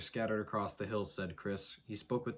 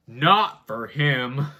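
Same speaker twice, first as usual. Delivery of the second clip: mock dread; 2 semitones higher, 13 dB louder, much slower than usual. Mock fright, slow loud.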